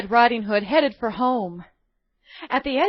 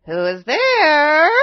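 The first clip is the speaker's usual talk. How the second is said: slow, loud, higher cry of a disguised wolf; hoarse wolf cry; 7 semitones higher, 10 dB louder, much slower than usual. Acted. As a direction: call out as a hoarse fake granny. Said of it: "cried the hoarse voice".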